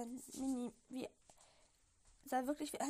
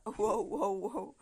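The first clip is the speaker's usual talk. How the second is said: loud, lower-pitched whoa; booming, repeated stop-right-there interjection; loud interruption; 4 semitones lower, 11 dB louder, much slower than usual. Acted. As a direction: cut in forcefully to stop someone. Interrupting an acted rattled-off list.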